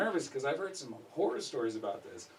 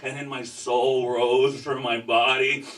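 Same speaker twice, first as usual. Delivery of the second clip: ordinary delivery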